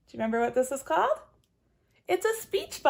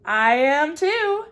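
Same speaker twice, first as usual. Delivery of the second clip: slow, loud rabbit voice; 2 semitones higher, 8 dB louder, much slower than usual. Acted. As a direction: stretch it out as the rabbit. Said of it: "The rabbit says".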